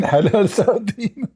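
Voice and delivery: cracking up mid-phrase; laughing. Real, not acted. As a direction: laugh through halal sardine. Laughing at the label.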